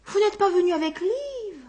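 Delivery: high, lightly voiced woman asking; a woman let down at the door; disappointed hostess.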